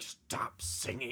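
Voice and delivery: slow, whispered telling-off; hushed stop. Retold or imitated. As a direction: hiss it slowly under your breath. Quoting herself mid-song.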